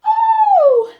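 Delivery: very high, loud yelp; cry of surprise. Acted. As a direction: yelp while slipping over. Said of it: "he cries".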